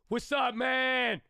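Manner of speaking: high, slow, drawn-out hype greeting; excited greeting. Retold or imitated. Imitating how he greets friends, an excited drawn-out call. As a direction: stretch it out like greeting a buddy across the room. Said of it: "and I get real excited".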